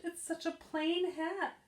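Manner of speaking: high, slow, let-down voice; disappointed girl. Acted. Girl complaining about the plain hat, let down. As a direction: sound crushed by the plain hat. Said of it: "she gasped".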